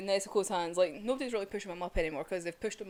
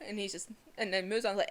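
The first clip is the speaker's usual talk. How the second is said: ordinary talk, nothing marked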